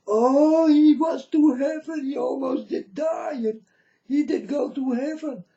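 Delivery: high mock-teary gushing; mock teary believer. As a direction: ham up a weepy believer. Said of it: "and you will say".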